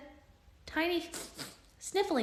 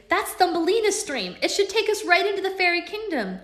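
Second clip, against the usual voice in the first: excited; 10 semitones higher, 6 dB louder than usual. Acted. Alex excited about fairy kingdom.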